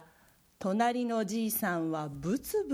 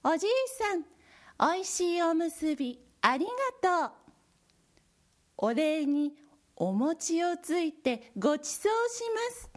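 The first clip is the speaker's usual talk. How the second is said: high cheerful mouse; bright tiny squeaky thanks; 7 semitones higher than usual. Acted. Mice thank the neighbor.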